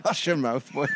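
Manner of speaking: hammed-up Irish father scolding; bad Irish-Scottish accent; mock Irish accent. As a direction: tell the boy off in a put-on brogue. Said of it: "bad Irish slash Scottish accents".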